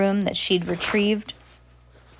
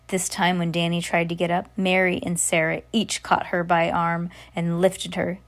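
plain reading voice

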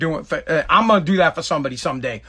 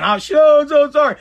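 high, louder imitation of wailing; mock whining apology; 7 semitones higher, 5 dB louder than usual. Mom begging son's forgiveness, mock whining. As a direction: whine the apology in exaggerated misery. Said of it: "Whining like".

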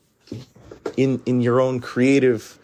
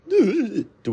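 voice cracking; 13 semitones higher than usual. Real, not acted. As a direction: voice cracks on the repeat. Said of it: "Sorry for that voice crack".

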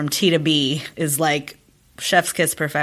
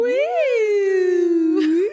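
excited cheer; 14 semitones higher than usual. Real, not acted. Cheering about new merch.

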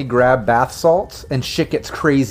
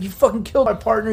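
high-pitched strained accusation; aggrieved prisoner; 9 semitones higher, faster than usual. Acted. Game master as prisoner whose partner was killed, aggrieved. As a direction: accuse them while fighting your bonds. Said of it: "struggling with the zip ties".

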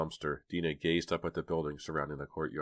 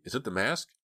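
high, eager question; excited; 7 semitones higher than usual. A narrator's reading. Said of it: "Dina cried excitedly".

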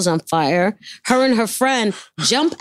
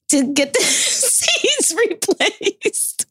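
words broken by real laughter; can't keep a straight face; laughing through it; 10 semitones higher, much slower than usual. Real, not acted. Telling the host about the half-burnt seats, laughing.

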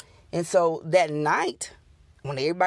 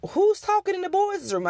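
higher, puzzled husband voice, lightly done; husband's voice; 14 semitones higher than usual. Retold or imitated. Husband asking about the boys' room: puzzled. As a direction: ask like her puzzled husband.

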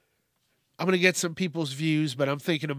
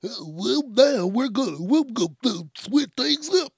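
higher, louder mock blocked-nose voice; stuffed-up nose; 9 semitones higher, 4 dB louder than usual. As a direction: exaggerate a blocked nose, pushing the pitch way up. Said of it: "I gotta blow my nose or something. I sound like a".

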